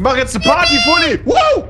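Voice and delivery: high, loud party cheer; excited shout. Real, not acted. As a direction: shout with glee.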